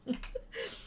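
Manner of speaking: high, soft trailing laugh; small high laugh; leftover giggles. Real, not acted. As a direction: giggle helplessly as it subsides. Still laughing after giving up.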